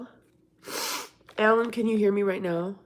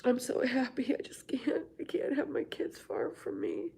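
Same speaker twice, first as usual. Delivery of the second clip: tearful happy whisper; 4 semitones higher, 5 dB quieter than usual. Real, not acted. Tearful relief son staying home.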